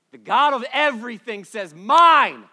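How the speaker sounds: loud, high preacher's shout; thundering claim. Acted. Preacher declares God's claim on believers, thundering. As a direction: build to a huge, high shout on MINE. Same claim repeated at full force.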